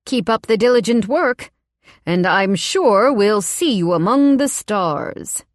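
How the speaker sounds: a hint of a smooth, fake-polite man; smooth it over with menace; lightly voiced oily boss. Boss smoothing over his threat, in a lightly oily voice.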